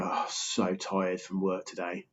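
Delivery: slow, soft, drained moan; weary office worker. Retold or imitated. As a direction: play the exhausted colleague slumped on the sofa. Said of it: "just go".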